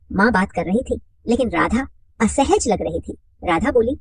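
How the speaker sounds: ordinary delivery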